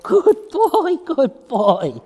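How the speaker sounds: put-on high, bright "Good boy" praise; excited dog-praise voice; happy praise voice. Shown as a demonstration. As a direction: sing it out happily to the dog. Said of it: "when you say his name".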